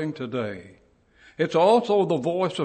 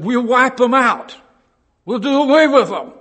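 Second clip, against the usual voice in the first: loud, higher, furious vow; emperor's threat; 9 semitones higher, 11 dB louder than usual. Retold or imitated. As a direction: bellow it like a tyrant emperor. Emperors vow to destroy Christians.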